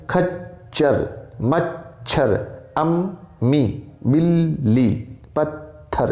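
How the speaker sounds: very slow खच्चर, मच्छर, अम्मी, बिल्ली, पत्थर, each double consonant held; doubled consonants. Shown as a demonstration. Teacher listing tashdeed examples to students, slowly drawn out. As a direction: say each word very slowly, holding the doubled sound. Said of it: "दो बार आवाज़ आ रही है".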